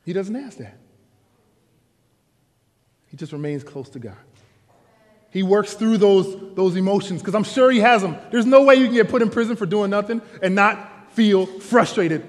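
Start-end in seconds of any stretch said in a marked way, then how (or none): 5.3-12.3 preaching at full force. Acted. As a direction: drive the point home to the congregation.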